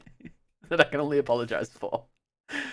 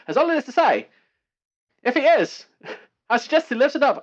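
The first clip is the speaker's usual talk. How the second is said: bright, high news anchor read; newsreader voice; 8 semitones higher, 4 dB louder than usual. Acted. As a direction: read it like an old newsreel announcer. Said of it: "This is my newsreader voice".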